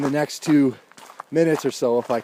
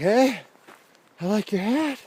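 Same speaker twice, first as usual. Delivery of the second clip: friend's voice; 8 semitones higher than usual. Retold or imitated. Friend greets him, retold.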